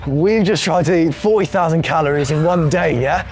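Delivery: presenter intro voice. Retold or imitated. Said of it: "This is how it went".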